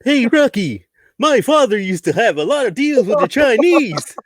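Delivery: high goofy cartoon moose voice; Bullwinkle voice. Bullwinkle impression.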